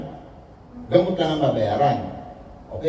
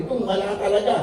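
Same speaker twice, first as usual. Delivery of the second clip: ordinary delivery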